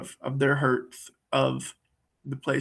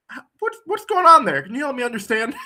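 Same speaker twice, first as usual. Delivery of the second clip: much higher, louder puzzled question; curious warrior; 9 semitones higher, 7 dB louder than usual. Retold or imitated. Circler questioning a partner's brave face, high and curious. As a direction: poke at the dissonance, half laughing. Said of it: "warrior is this aspect of circling".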